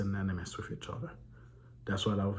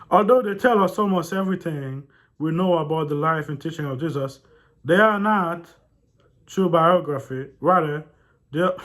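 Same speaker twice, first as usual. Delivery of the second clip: loud, higher read-aloud voice; 8 semitones higher, 12 dB louder than usual. A narrator's reading. Reading a disputed book to viewers, loud read-aloud voice. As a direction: project the text out loud.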